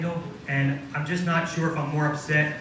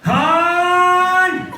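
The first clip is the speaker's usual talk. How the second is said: high, loud bark of a name; shrill nagging yell; 13 semitones higher, 7 dB louder than usual. Acted. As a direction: yell across the house at a spouse. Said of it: "That's my sexy voice now".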